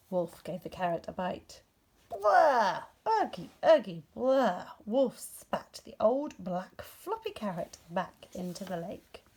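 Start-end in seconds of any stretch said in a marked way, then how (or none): 2.1-4.7 loud, high yuck noises; disgusted spitting. A narrator's reading. Wolf spits out the rotten carrot in loud disgust. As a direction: spit out a foul taste.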